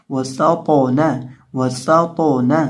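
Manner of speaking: वसातौना with a hissing S and heavy echoing T; slow recitation of वसातौना, whistling the S; whistled S, bounced T. Shown as a demonstration. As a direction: bounce the thick तौ in वसातौना before ना. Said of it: "हिलाकर पढ़ेंगे और सीन में सीटी की आवाज़".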